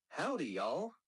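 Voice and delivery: friendly Southern American greeting; Southern US accent demo; Southern US drawl. Shown as a demonstration. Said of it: "you might hear a drawl like".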